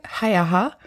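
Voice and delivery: usual speaking voice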